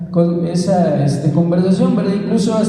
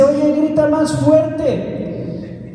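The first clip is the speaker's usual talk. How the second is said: raised, drawn-out call to a speaker; calling from the back; 6 semitones higher, much slower than usual. Retold or imitated. Someone at the back urging the preacher to shout, calling out. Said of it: "al fondo una persona".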